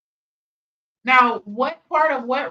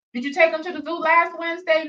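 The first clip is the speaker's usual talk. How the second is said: mock mother nagging; 6 semitones higher, faster than usual. Mocking the other woman's talk.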